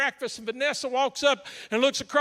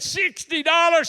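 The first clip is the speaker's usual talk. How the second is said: loud, high, drawn-out disbelief; slow, high incredulous outburst; 6 semitones higher, 7 dB louder, slower than usual. Retold or imitated. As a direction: balk at the price in mock shock. Pastor balks at bigger request.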